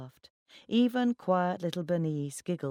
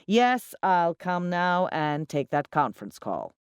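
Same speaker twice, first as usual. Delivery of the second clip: loud, slow announcement; said loudly; 3 semitones higher, 6 dB louder, slower than usual. A narrator's reading. Ex covering for a phone call, speaking loudly. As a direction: start high and loud, then spell it out slowly. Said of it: "he said loudly".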